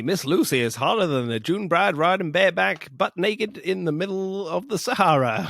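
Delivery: a hint of the brash Texan; Texan quote. Podcaster quoting the American suitor, light Texan accent. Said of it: "he says".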